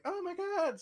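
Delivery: very high mock shriek of terror; panicked townsfolk. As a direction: squeal it like a terrified villager.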